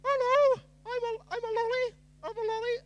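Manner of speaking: high-pitched squeaky candy character; lolly voice. Shown as a demonstration. Reader trying out a voice for the lolly, high and squeaky. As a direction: pipe up very high as a talking lolly. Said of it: "this will be my lolly voice".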